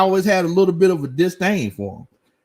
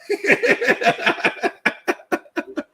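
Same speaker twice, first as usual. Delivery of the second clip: high laugh. Real, not acted. Laughing at own joke.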